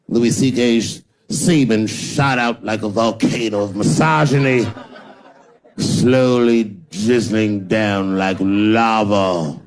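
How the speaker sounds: slow, grandiose newspaper narration; mock literary prose. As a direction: read it like overwrought prize-winning journalism. Mock Pulitzer prose parody.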